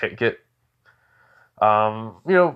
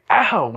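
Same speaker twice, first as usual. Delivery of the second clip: high, loud "ow"; short acted yelp, pitched up; yelp of pain; 8 semitones higher, 5 dB louder than usual. Boy yelps while the old man listens, pained cry. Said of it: "just hears a".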